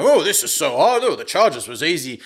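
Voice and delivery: high, loud mocking whine; whiny linebacker. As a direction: whine like a spoiled player. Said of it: "can walk into Chiefs camp and be like".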